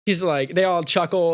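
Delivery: normal talking voice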